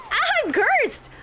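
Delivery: high loud dismayed cry; real alarm, yelled; shocked shriek. Real, not acted. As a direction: shriek the bad news. Character gets cursed.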